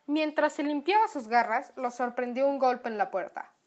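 plain reading voice

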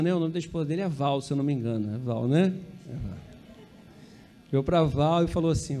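ordinary talk, nothing marked

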